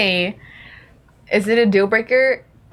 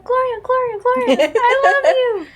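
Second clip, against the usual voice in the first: much higher, swooning dog gushing; adoring dog voice; 14 semitones higher than usual. Pretend. Dog speaking to grandma's friend Gloria, high giddy adoration. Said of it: "if he could talk, he'd be like".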